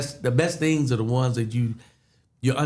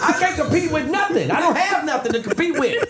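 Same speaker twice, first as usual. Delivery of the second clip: laughing panic. Retold or imitated. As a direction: half-shout it through laughter, flustered. Retold panic over surprise contest.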